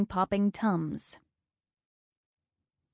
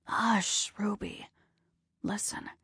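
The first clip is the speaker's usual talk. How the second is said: much softer, nearly whispered; hushed; 6 dB quieter than usual. Acted. Dying husband hushing his wife, much softer. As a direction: hush her gently, almost without voice.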